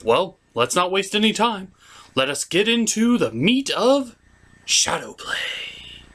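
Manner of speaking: usual speaking voice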